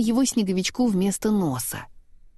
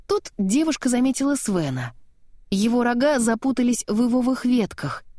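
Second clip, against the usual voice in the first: plain reading voice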